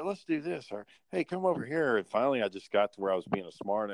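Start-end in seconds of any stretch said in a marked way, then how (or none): 0.0-0.7 put-on high little-kid voice; pestering kid. Kid suggesting things to the older players, pestering. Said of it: "this kid. He kept talking to us". 1.1-2.0 put-on high-pitched child calling over; pestering kid. Kid calling other players over to him, pestering. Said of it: "this kid. He kept talking to us".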